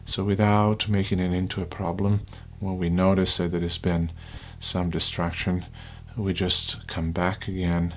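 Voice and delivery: unhurried, hushed guidance, phrase by phrase; guided meditation. Acted.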